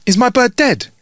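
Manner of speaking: high-pitched anxious question; acted high-pitched query; worried question. Pet owner asks the vet.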